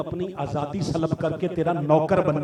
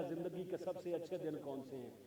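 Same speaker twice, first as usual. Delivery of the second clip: reverent question; 14 dB quieter than usual. Acted. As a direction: sink into a hushed, respectful enquiry. Reverent retold question to prophet Ayyub. (अदब भरी, धीमी और नीची आवाज़)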